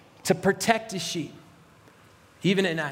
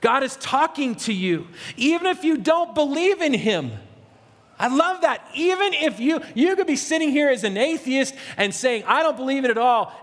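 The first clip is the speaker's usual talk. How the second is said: raised-pitch, urgent sermon delivery; impassioned preaching; 6 semitones higher than usual. Real, not acted. Preacher telling even atheists that God speaks, impassioned preaching. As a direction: press it home to the room.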